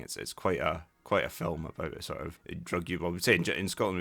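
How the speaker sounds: ordinary delivery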